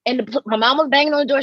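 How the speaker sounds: usual speaking voice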